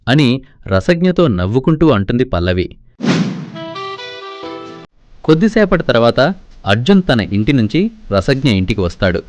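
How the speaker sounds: usual speaking voice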